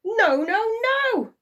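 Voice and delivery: very high, loud gleeful no; gleeful goblin cheer; cheering imp. A narrator's reading. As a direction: crow with glee. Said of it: "cheered the little man".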